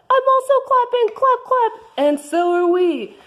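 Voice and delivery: high Martha voice. Acted. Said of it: "I am Martha".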